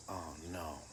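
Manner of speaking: low, very soft worried aside; hushed, sinking realization; dread under breath. Retold or imitated. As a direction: mutter it to yourself with dread. Dreads coach stumbling on name.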